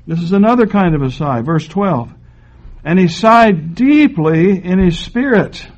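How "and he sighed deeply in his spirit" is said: slow, heavy sighed deeply; slow dramatic reading. Shown as a demonstration. Preacher reading the verse of Jesus sighing, slow and heavy. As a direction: draw out the sigh in the verse. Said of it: "Almost a groan rises up".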